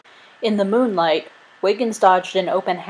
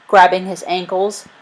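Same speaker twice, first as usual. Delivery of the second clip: ordinary delivery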